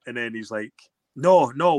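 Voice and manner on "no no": teammate's refusal. Retold or imitated.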